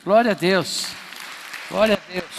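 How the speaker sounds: loud, high acclamation; shouted praise. Real, not acted. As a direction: lift the church with a cry of praise. Pastor shouting praise after testimony.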